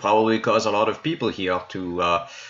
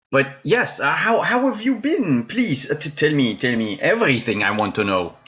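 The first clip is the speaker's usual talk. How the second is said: eager; 8 semitones higher than usual. Acted. Eager in-character question.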